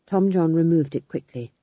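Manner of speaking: plain reading voice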